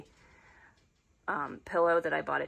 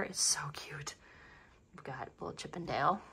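whisper; 4 dB quieter than usual. Real, not acted. Cooing over an ornament.

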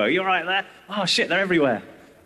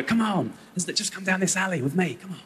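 creepy coaxing; 5 dB quieter than usual. Retold or imitated. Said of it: "I go".

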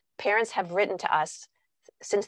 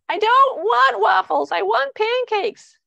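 put-on much higher, slow, loud little girl; whiny daughter; 14 semitones higher, 6 dB louder, slower than usual. Daughter demanding pancakes from mom, high, loud whining. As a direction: whine like a small child demanding pancakes.

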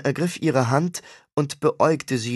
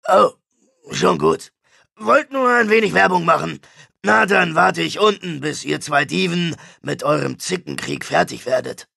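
louder, higher, breezy pitchman; cheeky salesman; 4 semitones higher, 4 dB louder than usual. Acted. Sam plugging his security firm mid-argument, cheeky. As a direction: chirp in with an unwanted plug.

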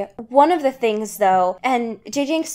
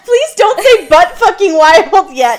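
high, loud laughing plea; laughing protest; 8 semitones higher, 8 dB louder than usual. Real, not acted. Host begging co-host to stop, high laughing plea. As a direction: beg him to stop, laughing. Reacting to co-host's crude phrase.

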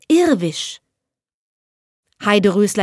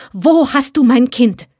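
louder, desperate demand; calling out; 4 semitones higher, 5 dB louder than usual. Acted. Unhappy mother demands her child from the spirit, louder. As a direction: call it out in a grieving mother's fear. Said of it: "rief die unglückliche Mutter".

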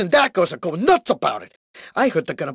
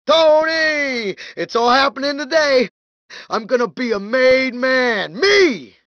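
excited, drawn-out call to a pal; 2 semitones higher than usual. Acted. Man about to be made tells Tony, excited. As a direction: crow about the big day. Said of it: "I'm so excited".

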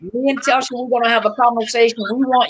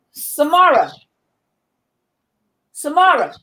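calling out; 5 semitones higher than usual. Real, not acted. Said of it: "I'm just hollering".

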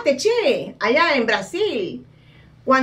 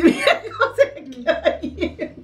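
high, laughing cat voice; the cat speaking, high and amused; cat's voice; 7 semitones higher than usual. Retold or imitated. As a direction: voice the staring cat with a giggle.

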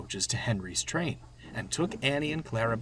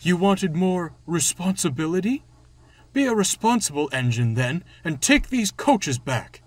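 loud, higher angry dressing-down; fuming; 7 semitones higher, 7 dB louder than usual. A narrator's reading. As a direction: tell off the other engine crossly. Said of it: "he fumed".

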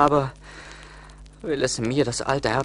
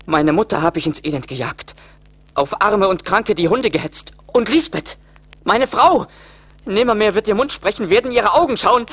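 louder and higher, despairing lament; rising anguish, growing to a cry; 8 semitones higher, 7 dB louder than usual. Acted. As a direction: let the guilt build into a wail. Peter remembers his sins.

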